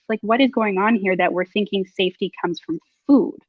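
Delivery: ordinary talk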